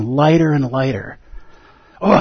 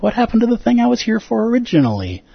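puzzled inner voice; 11 semitones higher than usual. Retold or imitated. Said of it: "and you're like".

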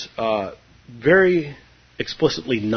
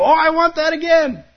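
much higher, pleading mock whine; a hammed-up student begging for more; whiny craving; 5 dB louder than usual. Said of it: "and you go".

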